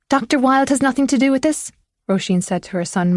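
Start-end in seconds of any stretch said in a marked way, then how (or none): 0.1-1.7 high-pitched mocking echo; mocking his tone. A narrator's reading. Mother throwing her son's words back at him, mocking. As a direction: parrot it back higher, mockingly. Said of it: "mocking his tone".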